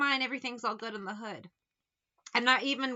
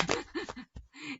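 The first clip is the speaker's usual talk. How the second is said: laugh; 5 semitones higher, 3 dB quieter than usual. Real, not acted. Laughs after retort.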